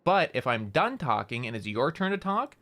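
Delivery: "talk" climbing at the end; rising tune up to "talk", sentence not finished. Shown as a demonstration. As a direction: keep it open, lifting the final "talk". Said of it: "we kinda raise the end".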